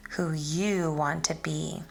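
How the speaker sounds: ordinary delivery, nothing marked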